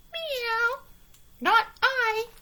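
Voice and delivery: a prissy cat saying no. A narrator's reading. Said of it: "said the cat".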